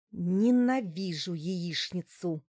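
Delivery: slow, seething loathing; through gritted teeth. A narrator's reading. Larisa staring at her plate in disgust, seething. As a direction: force it out slowly through clenched teeth. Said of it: "процедила она сквозь зубы".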